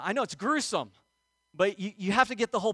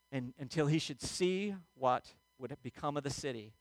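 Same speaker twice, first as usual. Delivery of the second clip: ordinary talk, nothing marked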